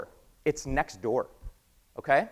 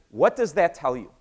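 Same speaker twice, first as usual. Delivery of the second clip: ordinary talk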